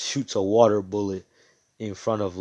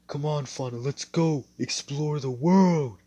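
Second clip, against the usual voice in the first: pleading call; 5 semitones higher, 4 dB louder than usual. Acted. Calling out to Fauna to explore.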